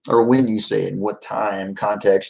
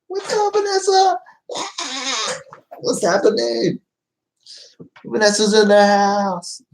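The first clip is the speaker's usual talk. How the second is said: much higher, sing-song hello; high playful greeting; 13 semitones higher, slower than usual. Acted.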